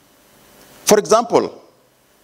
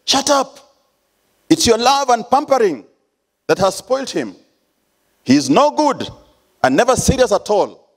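angry outburst; 9 semitones higher than usual. Retold or imitated. Husband silencing his wife about their son, high furious shout. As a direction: cut her off in a rage. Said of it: "But I interjected".

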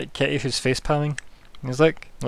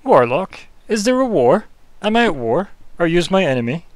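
hammed-up mock outrage, higher and louder; faux shock; 6 semitones higher, 8 dB louder than usual. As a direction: feign offended surprise, voice up and louder. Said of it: "that faux shock".

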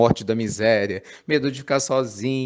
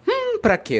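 much higher, playful hum, pra quê; coy teasing reply. Pretend. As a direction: tease them with a sly singsong. (resposta provocadora, bem aguda e brincalhona)

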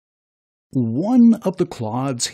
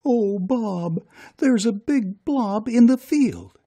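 worried wife; 10 semitones higher than usual. Acted. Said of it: "Bob heard his wife sob".

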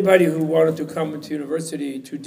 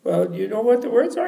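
high questioning voice, rising end; cleaning lady; 8 semitones higher, 4 dB louder than usual. Acted. Cleaning lady tests the scholar, high rising question. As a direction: probe him as the cleaning lady. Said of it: "She said, uh".

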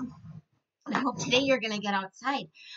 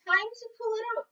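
farmer voice; 12 semitones higher than usual. Acted. Farmer's quote in story.

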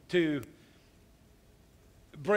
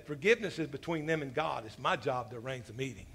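action hero line; 6 semitones lower, 7 dB quieter than usual. Retold or imitated. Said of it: "here comes the line".

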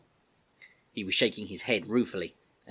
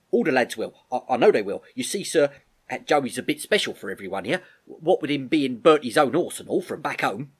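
louder, colloquial appeal from David; earnest young soldier; 4 semitones higher, 7 dB louder than usual. Acted. David telling the Major the lads will help, earnest. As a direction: back your friend earnestly, respectful to the officer. Voices David joining the plea.

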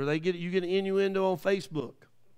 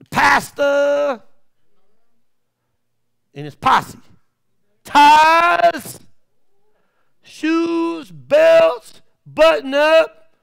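loud, high fired-up preaching; preaching at full force; 6 semitones higher, 11 dB louder than usual. Acted. Preacher's story at full volume.

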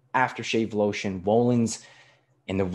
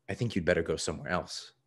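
softer, lower, quick reply; quiet waiter; 4 semitones lower, 6 dB quieter, faster than usual. A narrator's reading. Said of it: "the waiter said quietly".

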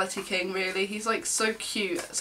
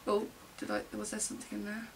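ordinary delivery

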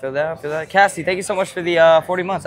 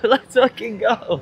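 hyped celebration; 6 semitones higher, much slower than usual. Real, not acted. Celebrating gifted subs.

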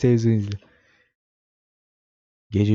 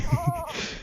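high giggle; 8 dB quieter than usual. Real, not acted. Laughing after baby talk.

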